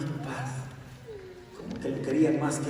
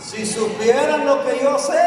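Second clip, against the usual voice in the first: shouted tease; 10 semitones higher, 11 dB louder, slower than usual. Acted. Child shouts to parents.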